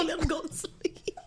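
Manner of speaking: whispered with a laugh. Real, not acted. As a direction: breathe it out as a giggling whisper. Echoing advice about the hurt dog.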